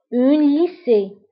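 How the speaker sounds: "Une lycée" said slowly again, syllable by syllable; teacher repeats for the class to copy. Shown as a demonstration. Slow drill.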